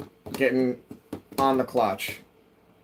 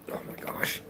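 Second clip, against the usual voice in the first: breathless whispered aside; 11 dB quieter than usual. Real, not acted. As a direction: whisper it to yourself. Whispered reaction to the cat.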